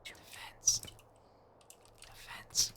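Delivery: urgent hushed whisper. Real, not acted. Calling quietly in a tense round.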